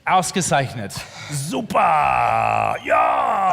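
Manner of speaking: loud mock-German cheer, last words drawn out; playful German exclamations, building to a shout; hammed-up German. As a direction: shout it like an over-the-top German. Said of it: "In a German accent".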